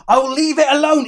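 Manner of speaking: high, loud burst of real laughter; laughing. Real, not acted. Teacher cracks up at a pupil's cheeky sentence, laughing out loud. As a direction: laugh it off, then speak through the laugh. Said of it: "That sounds like a song".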